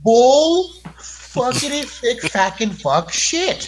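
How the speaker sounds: slow, drawn-out exasperated cursing; outraged swearing. Real, not acted. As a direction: spit out each curse word slowly in disbelief. Outraged reaction to sin answer.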